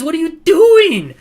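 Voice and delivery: real exasperated shout at his own forward; furious yell. Real, not acted. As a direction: scream in disbelief at the player. Yelling at player who didn't shoot.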